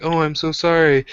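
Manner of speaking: high, polite mock apology; mock Japanese apology. Mocking apologetic Japanese locals. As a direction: chirp a sugary apology. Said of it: "everyone in Japan's like".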